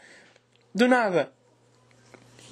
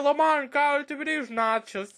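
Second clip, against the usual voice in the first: retold shout; 8 semitones higher than usual. Friend calling across the cinema about spilled nachos, mock shout. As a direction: call it out high without much extra volume. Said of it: "Ele literalmente gritou".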